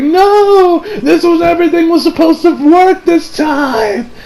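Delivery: put-on boy's tearful scream of despair; despairing cry. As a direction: cry out as if everything has collapsed. Hayato despairs at episode end.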